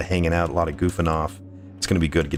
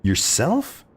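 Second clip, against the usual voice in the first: high rising disbelief; surprised; 8 semitones higher than usual. Real, not acted. Streamer amazed that a viewer cooked the whole meal, rising. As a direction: ask it again, really amazed.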